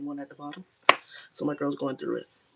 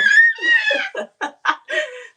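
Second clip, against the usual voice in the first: high, loud burst of laughter; real delighted laugh; big laugh; 13 dB louder than usual. Real, not acted. Baby in good mood, she laughs.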